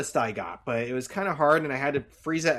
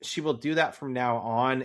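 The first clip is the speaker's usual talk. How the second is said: normal talking voice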